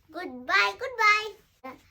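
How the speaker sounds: cheery goodbye. Real, not acted. Signing off the video to friends, excited goodbye. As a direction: wave the friends off loudly.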